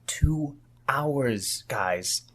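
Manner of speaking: hushed, breathy aside to the group; conspiratorial whisper. Acted. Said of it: "the room takes on an air of conspiracy".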